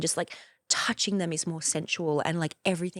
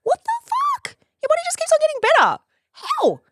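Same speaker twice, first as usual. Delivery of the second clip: much higher and louder; delighted disbelief; shrieking self-admiration; 5 dB louder than usual. Pretend. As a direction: squeal at your own reflection. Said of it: "I'm always like".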